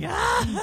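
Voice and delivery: high mock yelp of fright; startled scream. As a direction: jump with a startled cry. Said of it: "and I'm like".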